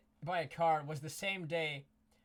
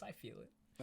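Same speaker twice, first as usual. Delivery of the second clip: whispering; 2 semitones higher, 16 dB quieter than usual. Real, not acted. Says it under his breath.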